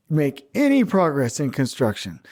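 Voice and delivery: ordinary talk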